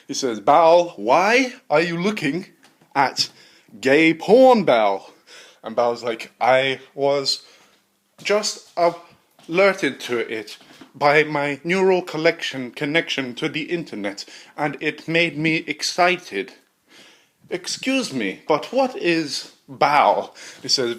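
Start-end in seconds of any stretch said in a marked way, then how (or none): 0.4-5.1 loud, slow, outraged scolding; scientist scolding. Acted. Scientist scolding his AI, loud and slow. 6.4-20.4 higher, loud, slow odd machine voice. Acted. AI explaining itself to its creator, high and slow.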